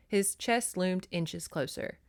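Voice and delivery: normal talking voice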